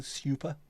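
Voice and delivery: "super" said as "syoo-per". Retold or imitated. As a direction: sneer at "super" with a y after the s. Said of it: "Where's the Y in super?".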